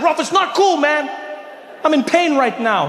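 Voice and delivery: high, pained objection; upset protest. Acted. Teased man telling his friend to stop, upset.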